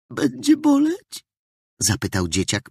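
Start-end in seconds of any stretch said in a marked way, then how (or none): 0.1-1.2 put-on high, slow little boy's voice, swallowing tears; frightened child. A narrator's reading. Hurt boy asks the healer, high and scared. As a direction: ask it like a scared small boy. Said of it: "przełykając łzy".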